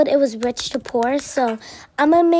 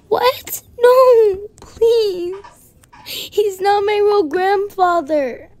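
pleading yell; 6 semitones higher, slower than usual. Acted. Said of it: "Honey, please stop yelling".

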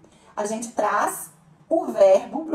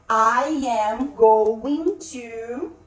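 drawn out word by word, a little louder; slow modelling; 5 dB louder, much slower than usual. Shown as a demonstration. English teacher showing learners the going to structure, very slow and deliberate. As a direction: spell it out slowly for learners.

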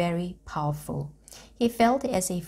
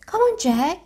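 calling out; 12 semitones higher, 7 dB louder than usual. A narrator's reading. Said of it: "she called".

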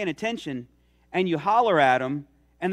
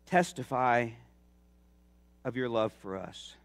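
prayer. Acted. Closing prayer.

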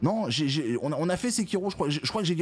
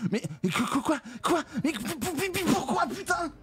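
high stuttering disbelief; 14 semitones higher, slower than usual. Real, not acted. Gamer sputters high at a baffling glitch. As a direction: stammer higher and higher, baffled.

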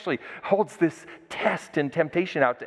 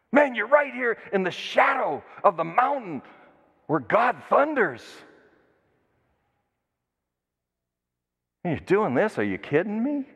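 incredulous rebuke; 6 semitones higher than usual. Acted. Preacher rebukes the people at the mountain.

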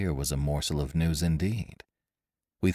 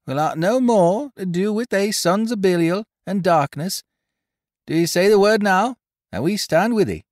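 high, loud seaman's declaration; honest sailor, fervent; 13 semitones higher, 7 dB louder than usual. Acted. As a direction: pledge loyalty at full voice. Voices sailor pledging loyalty to the girl.

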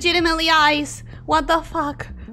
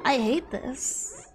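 defeated mumble; 3 semitones lower, 10 dB quieter, slower than usual. Real, not acted. Defeated after death.

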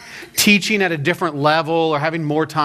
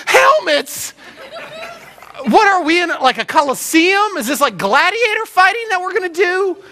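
mock incredulity; 12 semitones higher than usual. Novice rafter told to get helmets, hammed-up disbelief. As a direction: question it as if it's utterly absurd.

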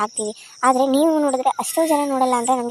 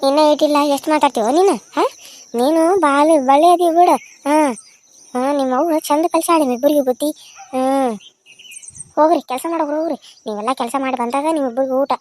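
angry scolding; 3 dB louder than usual. Acted. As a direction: snap them off to work. Grandma yells at the children. (ಮಕ್ಕಳನ್ನು ಕೆಲಸಕ್ಕೆ ಕಳಿಸುವ ಅಜ್ಜಿಯ ಜೋರು ಗದರಿಕೆ)

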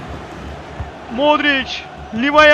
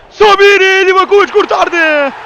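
screaming football commentator; 4 semitones higher, 5 dB louder than usual. Real, not acted. Decisive penalty saved.

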